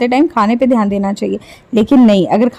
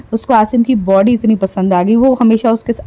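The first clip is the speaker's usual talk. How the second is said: ordinary delivery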